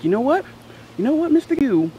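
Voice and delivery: high, loud mock warning; scolding teacher. Teacher warns pupil at his desk, mock scolding. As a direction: imitate a teacher laying down the law.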